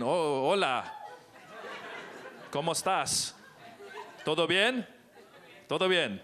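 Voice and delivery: hint of a Spanish accent. Shown as a demonstration. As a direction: greet her in a Spanish accent. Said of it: "I do it with a good accent".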